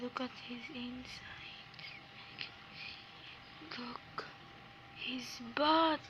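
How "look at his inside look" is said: whispering. Real, not acted. Hushed aside while playing.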